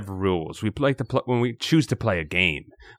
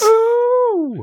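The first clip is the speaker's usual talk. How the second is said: excited ooh; 6 dB louder than usual. Real, not acted. Excited at the story start.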